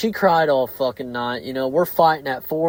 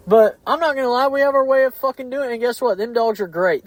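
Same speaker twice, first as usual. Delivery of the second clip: heated rant; 7 semitones higher, faster than usual. Real, not acted. Heated rant about dog critics.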